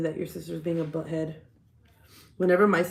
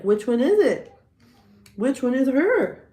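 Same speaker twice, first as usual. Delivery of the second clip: mock confusion; 6 semitones higher, 7 dB louder than usual. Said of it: "mock searcher puzzling".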